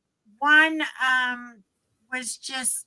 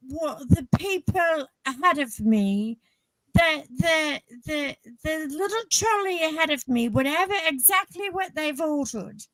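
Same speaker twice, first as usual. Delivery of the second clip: put-on English accent, pitched up; 5 semitones higher than usual. Customer orders the same as the car ahead, English accent. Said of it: "I did it in an English accent".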